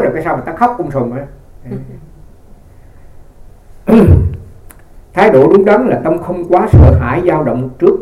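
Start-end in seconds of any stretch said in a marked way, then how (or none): 2.8-5.1 breathy amused laugh; a quick real laugh at the joke. Real, not acted. Laugh after joke about crying.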